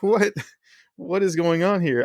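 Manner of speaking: high, amused what-is-going-on with a laugh in it; laughing disbelief. Retold or imitated. Seeing cowboys and horses at a gas station, laughing disbelief. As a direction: pitch it up and laugh through the surprise.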